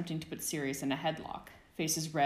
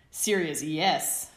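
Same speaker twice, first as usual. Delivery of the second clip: much louder defiant shout; 8 dB louder, much slower than usual. Acted. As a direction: yell it back gleefully. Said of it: "he shouted".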